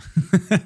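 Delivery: a quick, high, bright burst of laughter; short laugh. Real, not acted. Producer laughing at his own Daft Punk boast. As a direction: laugh at your own joke.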